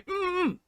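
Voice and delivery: high closed-mouth "mm" sounds; sewn-shut mumbling hum. Acted. Showing the three permitted hums, high muffled mm. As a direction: hum high with lips sealed. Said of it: "You can only do three".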